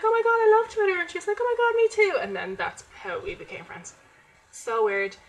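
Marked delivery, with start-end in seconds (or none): 0.0-1.0 very high, loud gushing; excited squeal. Retold or imitated. Excited first meeting with friend. 1.4-2.2 very high, loud friend's gush; excited squeal. Retold or imitated. Friend's excited reply voiced.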